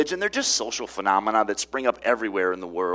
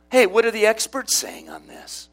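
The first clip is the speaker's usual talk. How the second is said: mock question; 9 semitones higher, 4 dB louder than usual. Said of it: "In other words".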